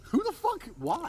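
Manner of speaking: sudden high-pitched disbelief; who would do that, jumping way up; incredulous outburst. Real, not acted. Shocked at scaring a girl.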